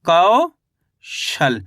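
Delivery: Kaushal split in two, long rising कौ, gap, then falling शल; teacher slowly sounding out Kaushal in two parts; syllable by syllable. Shown as a demonstration. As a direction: draw out कौ upward, pause, then drop on शल. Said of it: "तो मिलके बन गया अपने".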